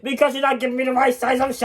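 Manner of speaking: fast, high, angry shout; furious high-pitched rant; mad shouting. Acted. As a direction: yell it in one rapid rush. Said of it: "why are you getting so mad".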